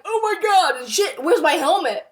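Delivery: retold panic, starting high; freaking out. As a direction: play up a touch of alarm. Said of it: "I was freaking out".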